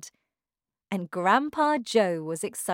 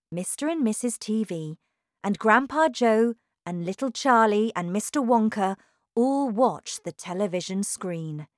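ordinary delivery, nothing marked

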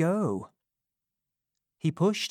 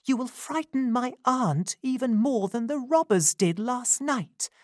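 put-on higher, gentle young lady's voice; a kind young woman taking charge; young woman Rose; 9 semitones higher than usual. Rose hushing Giles and ordering care, higher gentle voice.

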